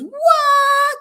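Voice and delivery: shrieked high and loud in mock disbelief; a jokey jaw-drop squeal; shocked what. Friend hears about a seventeen million grant, mock shocked shriek.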